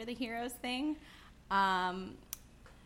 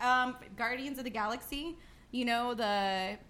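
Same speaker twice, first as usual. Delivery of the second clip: usual speaking voice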